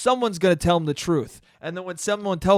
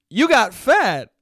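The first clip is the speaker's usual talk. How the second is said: higher, louder and slow, deadpan bluntness; blunt uncle; 7 semitones higher, 7 dB louder, slower than usual. Retold or imitated. Uncle Joe greeting dad bluntly, slow loud deadpan. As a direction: land each word like a blunt older brother. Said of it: "just looks at my dad and goes".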